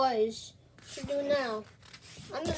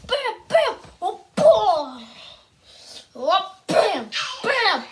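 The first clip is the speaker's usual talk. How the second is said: higher, louder bam punches with laughs; fight sound effects; 5 semitones higher, 5 dB louder, slower than usual. Acted. Mario pounds the cat, shouting a bam at each hit. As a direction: bash out fight noises, giggling.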